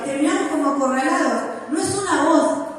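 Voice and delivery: usual speaking voice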